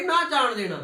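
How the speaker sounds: ordinary talk, nothing marked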